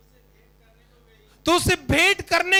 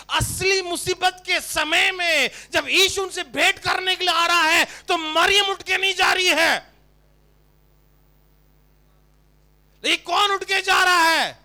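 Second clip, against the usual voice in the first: louder, higher, slowing preaching climax; building preaching; 5 semitones higher, 5 dB louder than usual. Acted. Pastor stressing Martha ran to Jesus, loud and slow. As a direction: build to a near-crying shout.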